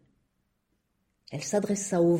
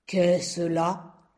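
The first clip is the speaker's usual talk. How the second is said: cavernous rock voice. A narrator's reading. The rock calls out to the seed fallen on him, cavernous. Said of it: "hurla-t-il d'une voix caverneuse".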